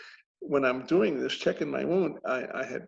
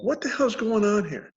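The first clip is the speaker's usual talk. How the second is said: raised, incredulous demand; mock indignation, pitched up; retold baffled exasperation; 7 semitones higher, 3 dB louder than usual. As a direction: act out confused annoyance, voice climbing. Retells baffled frustration while scanning.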